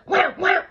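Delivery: high loud mock punch noises; punch sounds. Mimicking the buyer punching seller, loud. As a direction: smack it out, wham wham.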